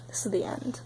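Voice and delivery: voiceless, hushed final whisper. Real, not acted. Ending her emotional vlog, whispering to viewers. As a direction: breathe it out like a last word. Dramatic close of emotional rant.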